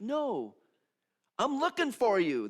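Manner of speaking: high, loud, insistent; master insisting. Acted. Master telling the messy ones he seeks them, loud. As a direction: reach out and insist. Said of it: "the master says".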